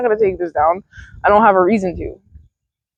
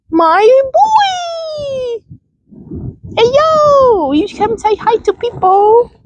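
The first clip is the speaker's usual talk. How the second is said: pet talk to dog; much slower than usual. Real, not acted. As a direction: gush up high at the dog. Greeting her dog on camera.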